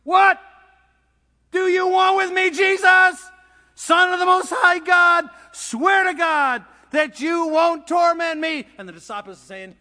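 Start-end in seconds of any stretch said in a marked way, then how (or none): 0.0-8.7 high, full-force demoniac shout; shouted, tormented protest; top-of-the-voice shout. Acted. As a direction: cry out loudly, stretching the last words. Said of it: "He shouted at the top of his voice".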